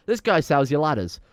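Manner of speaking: normal talking voice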